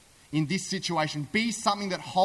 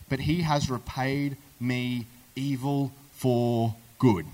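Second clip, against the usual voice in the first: slow, lower, word by word; slow grievance; 4 semitones lower, slower than usual. Acted. David reciting how he was repaid, slow and aggrieved. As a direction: spell out the wrong with weighty gaps.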